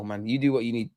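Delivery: normal talking voice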